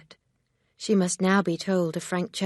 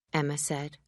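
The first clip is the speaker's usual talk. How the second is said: ordinary delivery, nothing marked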